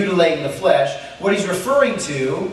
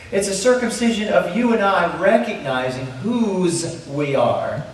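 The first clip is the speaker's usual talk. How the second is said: usual speaking voice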